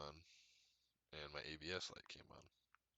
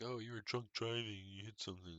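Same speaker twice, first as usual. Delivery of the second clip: higher-pitched mocking quote, starting loud; put-on voice of finger-waggers; mock accusers; 6 semitones higher, 4 dB louder than usual. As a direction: imitate people accusing you. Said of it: "People be like".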